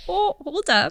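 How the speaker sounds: high, startled "Oh, hold up"; mock shock. Wife retelling her reaction to the kids, mock-startled and high. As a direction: pull up short in playful alarm.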